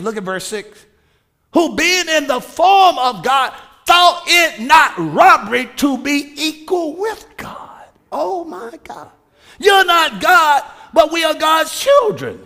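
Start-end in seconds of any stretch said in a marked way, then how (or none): 1.5-12.4 loud, rising preacher's proclamation; full force preaching. Acted. Pastor preaching inheritance to congregation, full force preaching. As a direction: preach it to the rafters.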